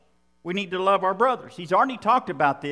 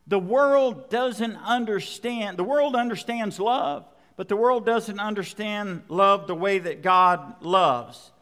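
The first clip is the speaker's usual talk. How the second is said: normal talking voice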